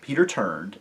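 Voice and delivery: ordinary delivery, nothing marked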